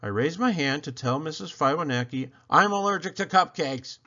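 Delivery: usual speaking voice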